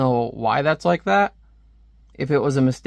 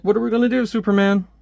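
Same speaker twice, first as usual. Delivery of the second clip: mock panic; 6 semitones higher, faster than usual. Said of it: "It's like".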